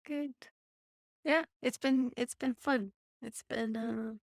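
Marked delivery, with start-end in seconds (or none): none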